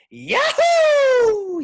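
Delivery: very high, very loud whoop; yell. Acted. Sherry celebrating elevators, very high loud yell. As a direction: whoop with pure joy. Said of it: "yelled Sherry".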